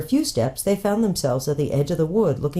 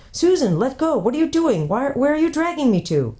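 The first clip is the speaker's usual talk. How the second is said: alarmed; 11 semitones higher than usual. A narrator's reading. Peter being dragged by magic.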